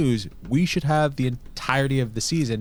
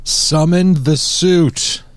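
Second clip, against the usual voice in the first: dramatic incantation; 4 semitones higher, 6 dB louder, much slower than usual. Acted. Sign-off catchphrase.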